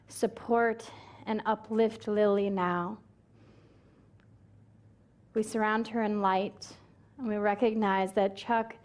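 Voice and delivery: gentle, unhurried spoken blessing; memorial prayer. Real, not acted. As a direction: lead a hushed congregation in prayer, fading to near-whisper.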